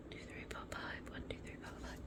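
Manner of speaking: quick count under your breath; whispered counting. Real, not acted. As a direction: whisper the numbers to yourself. Counts to self, checking items.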